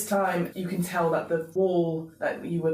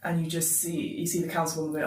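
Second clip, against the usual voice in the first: normal talking voice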